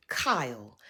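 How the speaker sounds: Kyle whispered, falling, no voice at all. Pretend. Recap host whispering Kyle's name in disbelief. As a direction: breathe his name like a disappointed aside.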